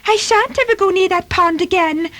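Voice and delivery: high-pitched, indignant kitten; offended. Acted. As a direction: protest in a huffy little voice. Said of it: "said Timmy in an offended tone".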